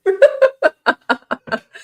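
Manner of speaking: high, loud burst of laughter. Real, not acted. Laughing at a chat joke.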